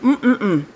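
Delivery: pleased mm after a sip; savoring hum. Real, not acted. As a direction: hum with delight over coffee. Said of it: "let me take a sip".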